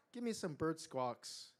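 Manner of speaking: plain reading voice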